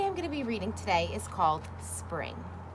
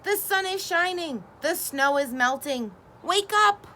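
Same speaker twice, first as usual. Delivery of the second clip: higher, rising to a loud cry; excited call; 7 semitones higher, 4 dB louder than usual. A narrator's reading. Frog crying out that spring has come. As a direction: rouse him with the good news. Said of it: "cried Frog".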